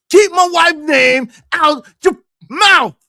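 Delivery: slow, loud, high warning shout; husband defending his wife, shouting; angry shout. Retold or imitated. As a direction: bark it like a furious husband.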